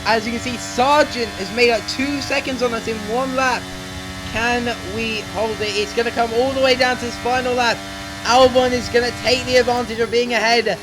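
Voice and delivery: fast-flowing, urgent racing commentary; live play-by-play. Real, not acted. Gamer calling his own final-lap battle to viewers, excited play-by-play.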